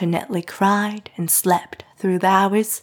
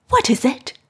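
higher, breathless frightened question; gasped question; 6 semitones higher than usual. A narrator's reading. Mrs Lennox hearing the servants wail, gasping. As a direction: ask in a startled gasp. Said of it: "Missus Lennox gasped".

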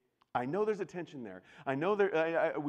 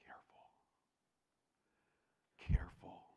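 hushed, urgent caution; soft whispered warning; 7 dB quieter than usual. Real, not acted. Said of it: "warning the congregation".

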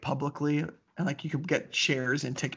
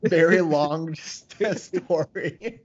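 laughing tease; 4 dB louder, much slower than usual. Pretend. Teasing, laughing slowly.

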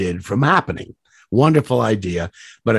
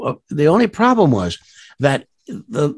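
ordinary talk

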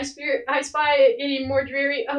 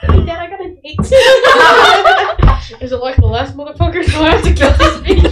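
laughing dad call; 4 semitones higher, 9 dB louder than usual. Acted. Dad on phone, laughing, louder.

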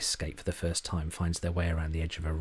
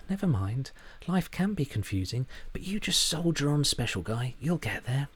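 higher, sing-song condescending comfort; patronising; 8 semitones higher than usual. A narrator's reading. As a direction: soothe him like a child. Charlie's smile's patronising voice.

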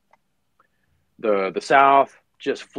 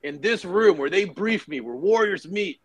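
higher, grand proclamation; proud colonel; 7 semitones higher, 3 dB louder than usual. Retold or imitated. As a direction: declare it like a proud commander. Colonel insists on his room.

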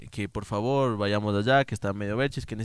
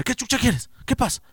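higher, louder, aggressive phone answer; tough-guy answer; 13 semitones higher, 6 dB louder than usual. Retold or imitated. Little boy challenging the unknown caller, aggressive.

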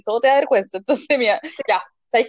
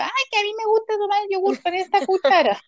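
higher, whining protest; whiny brat; 7 semitones higher than usual. Retold or imitated. Girl whining back at her mom over the spoon, higher and whiny. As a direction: sulk like a stubborn kid. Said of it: "Y yo".

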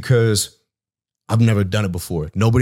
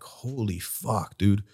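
hushed awe; 8 dB quieter, much slower than usual. Retold or imitated. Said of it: "I'm like".

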